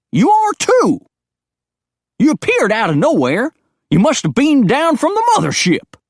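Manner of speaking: put-on high, loud, twangy old coot; crazy old hillbilly. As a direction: paranoid old mountain man, all fired up. Digger accuses alien.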